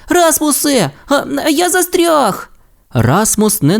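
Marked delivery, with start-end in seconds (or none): 0.1-2.5 very high stammering cry for help; scared shout. A narrator's reading. Timmi, sinking in the swamp, cries high to Rasmus. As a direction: panic while sinking. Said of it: "А тоді закричав".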